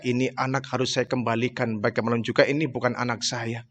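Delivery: a hushed, heavy-hearted lament; sad inner thought. Retold or imitated. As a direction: murmur it to yourself in grief. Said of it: "kesedihan yang sangat luar biasa".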